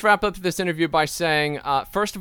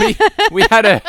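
laughing; 11 dB louder, faster than usual. Real, not acted. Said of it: "laughing while speaking".

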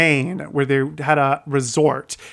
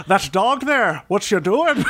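put-on higher-pitched stage Irish accent; 5 semitones higher than usual. Actor replaying a small cop role in Annie, Irish accent. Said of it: "did them in an Irish accent".

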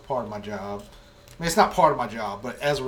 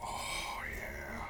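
very soft low seductive whisper; sultry whisper; 6 semitones lower, 13 dB quieter than usual. Pretend. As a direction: whisper it like a lover.

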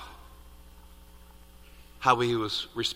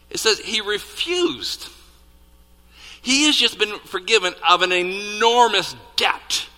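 loud, high-pitched disbelief; incredulous outrage; 9 semitones higher, 7 dB louder than usual. Acted. Preacher reacting to forgiven servant's refusal, incredulous. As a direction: hammer the key words in outraged disbelief.

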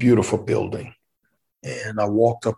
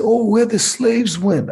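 much higher, mock governor voice; Mark Roscoe; 12 semitones higher, 3 dB louder than usual. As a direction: imitate the governor's bright question. Governor quoted in retold scene.

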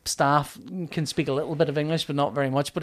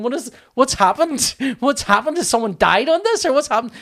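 high, louder, bewildered questioning; mock alarm; 9 semitones higher, 5 dB louder than usual. Queue baffled by a French tannoy announcement, mock alarm. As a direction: act out a queue growing alarmed.

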